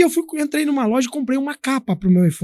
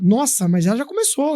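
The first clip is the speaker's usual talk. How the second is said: ordinary delivery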